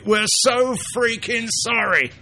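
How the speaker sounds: over-the-top Python-style sorry; Monty Python apology. Retold or imitated. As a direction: pile it on to absurd apology. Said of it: "that's a Monty Python thing".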